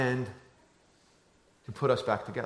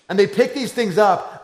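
normal talking voice

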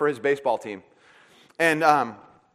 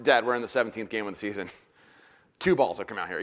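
bored son; 7 semitones lower, 6 dB quieter than usual. Retold or imitated. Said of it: "he looks at me like".

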